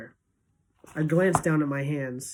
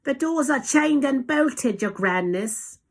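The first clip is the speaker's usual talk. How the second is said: high-pitched obedient reply; witch in the audience; 9 semitones higher, 3 dB louder, slower than usual. A narrator's reading. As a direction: call back high and slowly to your leader. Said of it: "answered a voice in the audience".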